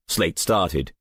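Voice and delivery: plain reading voice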